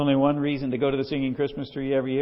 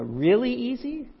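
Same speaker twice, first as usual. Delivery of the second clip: high, louder questioning echo; mock incredulous; 10 semitones higher, 5 dB louder than usual. Preacher echoing his blind friend's claim, mock incredulous. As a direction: toss it back as if amazed.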